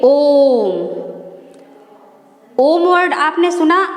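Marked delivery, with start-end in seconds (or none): 0.0-1.0 ओम held long and slow; teacher demonstrating a prolonged ओम; drawn-out om. Shown as a demonstration. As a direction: stretch ओम to three beats. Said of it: "तीन गुना समय लेता है".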